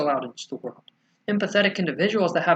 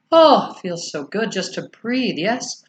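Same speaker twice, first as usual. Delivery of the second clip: normal talking voice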